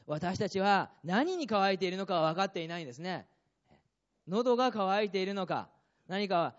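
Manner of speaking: loud, rising sermon delivery; fervent preaching. Acted. Pastor urging the congregation toward the Spirit, fervent and loud. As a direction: preach it with full conviction.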